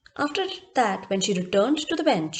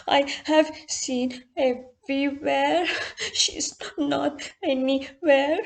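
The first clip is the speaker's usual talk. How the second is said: put-on sad little girl; 4 semitones higher, slower than usual. A narrator's reading. Lost-doll girl answers the man.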